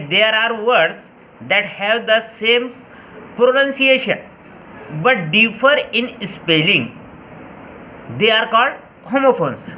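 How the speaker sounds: reads the English definition very slowly, word by word; dictating a definition to the class at very slow pace; slow dictation. Shown as a demonstration. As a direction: spell out the definition slowly for students to note down. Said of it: "जो definition है वो यूँ है कि".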